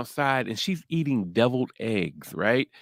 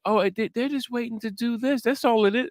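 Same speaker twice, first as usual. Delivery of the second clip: naive character; 12 semitones higher than usual. Retold or imitated.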